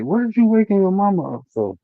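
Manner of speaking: high-pitched, softly exasperated question; retold baffled parent. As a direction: question a child in mild mock disbelief. Parent baffled by child waking mother.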